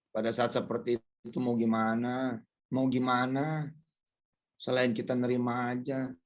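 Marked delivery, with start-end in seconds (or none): none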